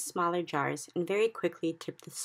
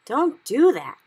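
higher, loud pained protest; loud complaint; 8 semitones higher, 12 dB louder, slower than usual. Acted. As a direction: protest sharply in pain. BFG hurt and complaining.

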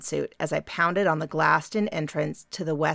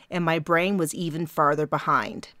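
ordinary delivery, nothing marked

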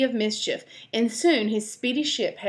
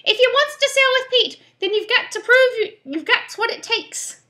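very high, loud parrot squawk; 7 dB louder than usual. Acted. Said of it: "announced the parrot".

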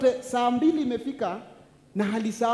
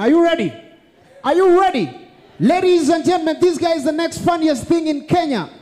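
loud, high announcer hype; grand entrance announcement; 6 semitones higher, 11 dB louder than usual. Acted. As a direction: build up the crowd like an MC. Host introduces comedian.

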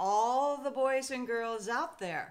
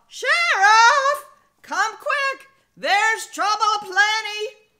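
high, loud urgent shout; deputy calling the sheriff out to trouble; frantic deputy yell; 10 semitones higher, 18 dB louder, slower than usual. Acted. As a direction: yell for help across town. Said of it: "yelled Deputy Bob".

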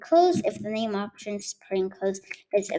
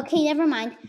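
ordinary delivery

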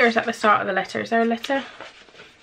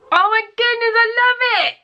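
much higher, squealed oh my goodness I love it; 1 squealing delight; 12 semitones higher, 3 dB louder than usual. Real, not acted. Thrilled by a friend's gift, squeals in delight. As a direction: squeal with joy.

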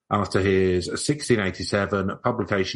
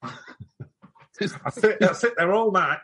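put-on much higher, fast old-timey voice; New Jersey pre-colonial accent; 9 semitones higher than usual. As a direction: whine like a frightened colonial farmer. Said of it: "It's a New Jersey pre-colonial accent".

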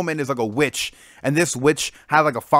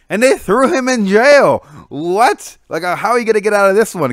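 excited disbelief; 7 semitones higher, 8 dB louder than usual. Real, not acted. Shocked retelling of a plot twist.

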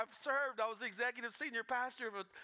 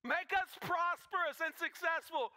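crowd's demand; 6 semitones higher, 5 dB louder than usual. Acted.

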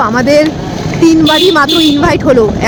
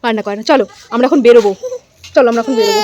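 usual speaking voice

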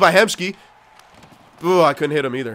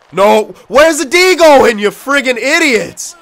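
slow, high, loud berating; angry yelling; 7 semitones higher, 5 dB louder, slower than usual. Real, not acted. Player berating the defense for leaving a gap, angry yelling. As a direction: holler at them, drawing the words out.

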